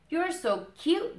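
very high, slow squeaky squirrel; very high cooing squirrel. Acted. Squirrel voiced.

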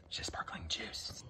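low whispered aside. Real, not acted. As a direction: whisper it to the camera. Whispered aside about fake champagne.

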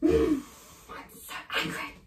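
sigh, then a near-whispered grumble; teacher demoing anger at a missed show, sighing and muttered; sulky anger. Shown as a demonstration. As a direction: mutter the anger under your breath. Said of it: "Okay, ready?".

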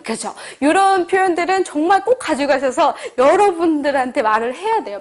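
ordinary talk

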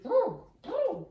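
very high, loud bouncy boom; excited boom. Acted. Trainer tempting her dog with a furry toy, high excited booms. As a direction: belt it out to rile him up.

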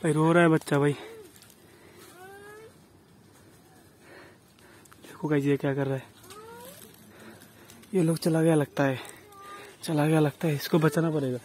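quiet, tense whispers while watching; hushed frightened narration; hushed and scared. Real, not acted. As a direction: keep your voice down, you're afraid of being heard. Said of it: "डर लग रहा है मेरे को".

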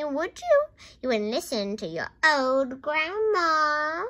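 loud, high grandma scold. A narrator's reading. Grandma scolds George for not listening, scolding loudly. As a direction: stretch the reproach out loudly.

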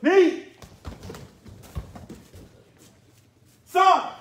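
sharp high count shouts; shouted count. Real, not acted. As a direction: bark out each count loud and high. Karate teacher shouting count.

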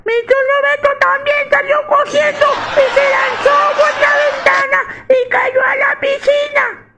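niño muy agudo y a gritos; grítalo como niño emocionado; niño gritón. Acted. Niño cuenta gritando. (niño agudo gritándole a su papá)

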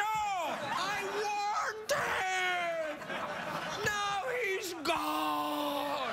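high, drawn-out mock wail; hammed-up anguish. Host to audience about the kid with plates, mock anguish. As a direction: lament it at the top of your range, stretching the last words.

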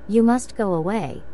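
ordinary delivery, nothing marked

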